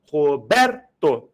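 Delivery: "Roberto" split up with BER punched hard; stressed syllable. Shown as a demonstration. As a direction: separate Ro, BER, to and lean on the middle. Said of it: "é classificada quanto à tonicidade".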